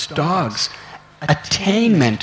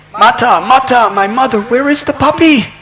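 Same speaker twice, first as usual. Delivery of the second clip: higher, louder call for mother; 7 semitones higher, 6 dB louder than usual. Acted. Boy running home calling his mother, high and loud. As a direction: shout across the house for mother.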